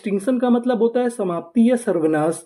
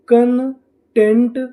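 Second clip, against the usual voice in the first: slow, stretched and a bit louder, rising at the end; slow blended word; 3 semitones higher, 4 dB louder, much slower than usual. Shown as a demonstration. Teacher to students, blending the syllables slowly. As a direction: draw the whole word out for learners. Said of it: "तो हमारी meaning क्या बनी?".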